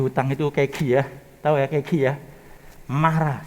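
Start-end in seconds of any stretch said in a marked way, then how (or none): none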